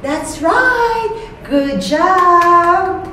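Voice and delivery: put-on high, bright praise for a child; kids show host. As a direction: cheer the kid on, sing-song.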